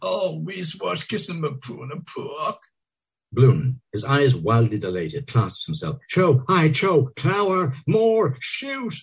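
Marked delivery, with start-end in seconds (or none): 0.0-2.6 high, hoarse, sweet moan. A narrator's reading. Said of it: "hoarsely, sweetly". 6.1-8.9 high frenzied cries. A narrator's reading. Bloom wildly aroused.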